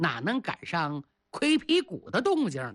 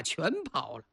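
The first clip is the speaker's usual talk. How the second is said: usual speaking voice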